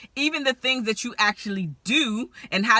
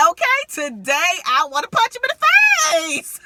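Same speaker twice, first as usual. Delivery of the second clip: higher and louder, fast, through laughter; half-laughing mock threat; laughing confession; 10 semitones higher, 7 dB louder than usual. Real, not acted. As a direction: giggle out the threat. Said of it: "I'm laughing".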